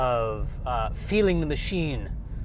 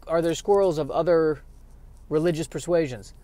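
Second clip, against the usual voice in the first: ordinary talk, nothing marked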